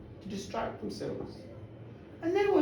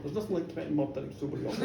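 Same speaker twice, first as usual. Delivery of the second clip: usual speaking voice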